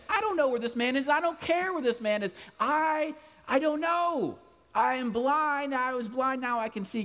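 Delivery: high, exasperated protest; exasperated outburst. Retold or imitated. Healed man snaps back at questioning neighbors, high and exasperated. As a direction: throw up your hands, he doesn't care. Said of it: "It's like".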